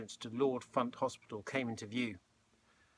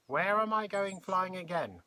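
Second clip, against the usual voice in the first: high, lilting, cheerful question; bright, eager old lady; 8 semitones higher, 4 dB louder than usual. A narrator's reading. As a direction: ask it brightly, pitched well up. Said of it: "she asked brightly".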